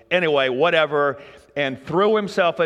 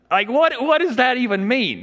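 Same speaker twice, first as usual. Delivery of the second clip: baffled disbelief; 9 semitones higher, 4 dB louder than usual. Retold or imitated. Disciples baffled by the instruction.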